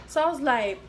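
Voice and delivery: ordinary talk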